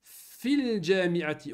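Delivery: "Fil jami'ati" drawn out slowly and joined; slow model reading. Shown as a demonstration. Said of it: "on dit pas fi al jami'a".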